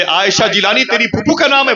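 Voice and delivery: high, excited questions one after another; a stranger's rising, excited questions; eager questioning. Acted. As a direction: ask it like you can hardly believe it.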